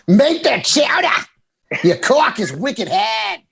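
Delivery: slow, hammed-up Boston accent. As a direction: drag out the Boston vowels for laughs. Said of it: "the unsexiest is probably Boston".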